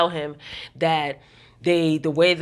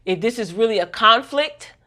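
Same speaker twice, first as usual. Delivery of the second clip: nice-not-nice warning; 3 semitones higher than usual. Retold or imitated. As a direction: imitate a boss's polite but pointed warning. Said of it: "in a nice but not so nice way".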